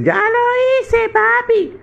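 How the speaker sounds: aguda y lenta de niño pequeño; voz de niño. Acted. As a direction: habla como un niño inocente. Niño responde al padre. (niño muy agudo contando a su papá)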